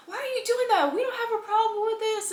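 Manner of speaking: high, indignant mock scolding; getting on him. Sister defending the crying girlfriend, high scolding retelling. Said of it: "so she got on him".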